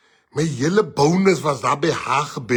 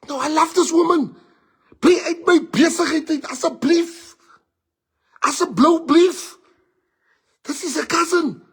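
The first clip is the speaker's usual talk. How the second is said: pleading lovesick friend; 11 semitones higher, 4 dB louder than usual. Retold or imitated. Friend begging him to stay out of it, high whining plea. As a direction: beg like a heartbroken fool. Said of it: "He's like".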